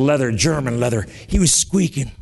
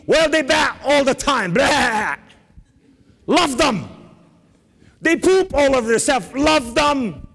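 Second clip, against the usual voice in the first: high-pitched, exasperated preaching cry; exasperated pulpit urging, pitched way up; impassioned exhortation; 10 semitones higher than usual. Real, not acted. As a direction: plead at the top of your register. Preacher exhorting about bothersome sheep.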